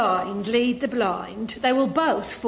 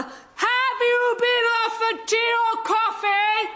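shouting; 9 semitones higher, slower than usual. Acted. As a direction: shout it without noticing. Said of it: "was I shouting?".

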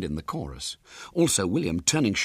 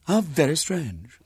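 high, slow, prim disapproval; a bossy lady, lightly voiced; measured, sniffy remark; officious lady; 8 semitones higher, slower than usual. Officious woman questioning William.

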